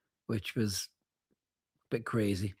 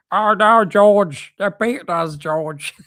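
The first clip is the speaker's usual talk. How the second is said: high, loud put-on puppet voice; Zippy; 7 semitones higher, 7 dB louder, much slower than usual. Zippy wailing to George about losing, high puppet voice. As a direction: cry out high in a cartoon voice. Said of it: "that was Zippy, wasn't it, though".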